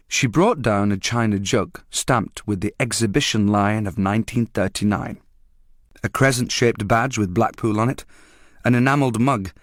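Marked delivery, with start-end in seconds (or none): none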